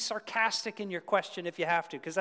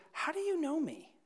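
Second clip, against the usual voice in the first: much higher, startled question; surprised Nathanael; 11 semitones higher than usual. Retold or imitated. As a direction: caught off guard, asking in wonder.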